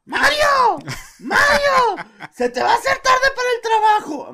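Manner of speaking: very high, loud shrieking call; shouting wife. Retold or imitated. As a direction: screech to hurry the husband off to work. Mother shouting at husband. (la vecina le grita a Mario que se le hace tarde, a todo pulmón)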